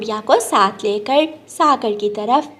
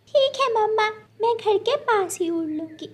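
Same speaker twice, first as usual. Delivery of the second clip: put-on much higher childlike voice, eager; little girl bird; 10 semitones higher than usual. Little Gudiya promising her mother, high childlike voice. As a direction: chirp it like a small obedient child.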